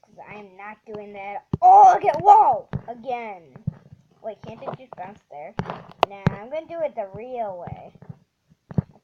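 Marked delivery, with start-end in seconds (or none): 1.6-2.7 much higher, very loud shout of surprise; startled yelp. Real, not acted. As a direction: caught off guard by the bounce. Sudden bounce in the game.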